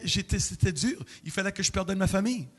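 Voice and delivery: ordinary talk, nothing marked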